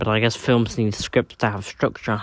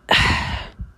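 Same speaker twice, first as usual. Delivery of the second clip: tired sigh. Real, not acted. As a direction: sigh it out wearily.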